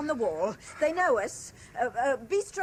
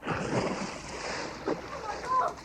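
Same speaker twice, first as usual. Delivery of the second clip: breathy gasp of horror, much higher; shocked gasp; 10 semitones higher, much slower than usual. Acted. Bystander spotting a woman in the river, breathless gasp. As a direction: gasp it out as if you just saw someone jump. Onlooker sees woman in water.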